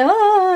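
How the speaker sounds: high, loud fake scream; badly faked cry of fright; mock scared cry. Nurse pretends to be hostage, mock scared "ah". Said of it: "pretending to be scared".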